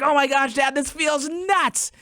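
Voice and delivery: put-on a kid squealing that it feels weird; daughter freaking out. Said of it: "She's gonna be like".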